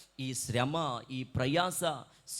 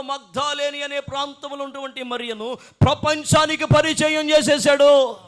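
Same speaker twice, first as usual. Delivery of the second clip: loud, building sermon shout; full-force preaching; 5 semitones higher, 15 dB louder than usual. Real, not acted. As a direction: proclaim it to the congregation with rising fervour. (గట్టిగా, ఆవేశంగా ప్రసంగం)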